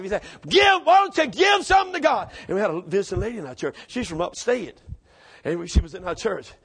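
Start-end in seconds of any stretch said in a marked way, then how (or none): 0.5-2.3 high, loud preacher's command; imitating an authoritative preacher at full shout; with authority. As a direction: holler it from the pulpit with authority. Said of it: "I mean, authority".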